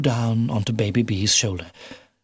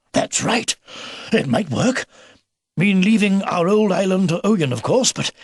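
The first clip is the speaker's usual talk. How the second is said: put-on raised, animated old fellow's voice; old beaver, excited; 8 semitones higher, 3 dB louder than usual. Old beaver weighing up the escape plan, excited. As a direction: think aloud with growing approval.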